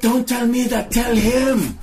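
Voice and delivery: very high, loud character shout; full-on shrieked retort; angry shout. Acted. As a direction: shout it back at them, high-pitched. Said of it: "she shouts at you".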